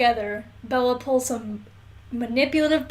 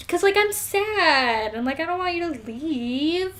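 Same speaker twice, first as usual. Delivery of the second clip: whiny Bella; 6 semitones higher than usual. Retold or imitated. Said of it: "she's just like".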